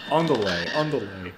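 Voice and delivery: Spanish word in a Russian accent; halting mock Russian accent; attempted Russian accent. Shown as a demonstration. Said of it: "Trying to say andale with a, uh, with a Russian accent".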